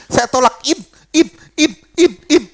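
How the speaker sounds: imitating a pastor stuck on the word in; stuck, unable to finish. Pastor mimics a bound man failing to say internet, stuck repeating. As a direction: repeat in as if the word won't come out. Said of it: "Nggak bisa ngomong internet".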